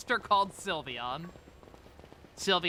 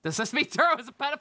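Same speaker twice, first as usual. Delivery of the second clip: excited; 10 semitones higher, 4 dB louder, much faster than usual. Real, not acted. Shocked joke.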